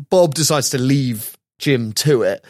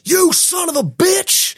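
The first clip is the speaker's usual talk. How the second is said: high, drawn-out mock shout; hammed-up irate caller, pitched way up; angry caller; slower than usual. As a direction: scream abuse down the phone. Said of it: "the voice at the end of the line just goes".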